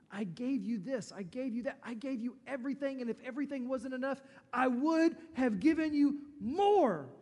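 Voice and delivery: loud, high, pleading hurt that slows toward the end; disappointed God. Acted. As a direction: cry out like a wounded father asking why. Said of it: "Can you hear the disappointment in God's voice?".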